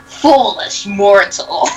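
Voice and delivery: slow menacing dark lord voice; drawn-out villain taunt. Acted. Villain line read slowly.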